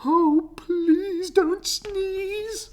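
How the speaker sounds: high, pleading little bird. Acted. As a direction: beg the elephant in a high chirpy voice. Birds voiced in story.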